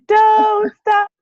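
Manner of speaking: mock cry. Mock cry at the video ending.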